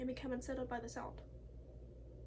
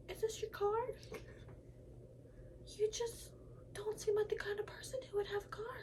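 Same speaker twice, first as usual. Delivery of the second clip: a mock Karen, higher and half-whispered; 8 semitones higher, 4 dB quieter than usual. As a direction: sneer it like a snooty Karen. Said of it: "racist Karens".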